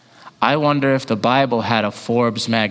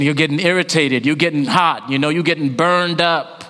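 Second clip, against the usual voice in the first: ordinary talk, nothing marked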